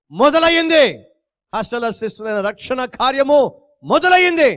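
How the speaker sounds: soaring high proclamation; triumphant proclamation. Real, not acted. Preacher to congregation, soaring high proclamation. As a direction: ring out the news of the resurrection.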